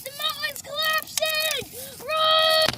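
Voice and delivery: high panicked cries; panicked shout. Real, not acted. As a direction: shout in alarm while fleeing. Mountain collapsing, fleeing.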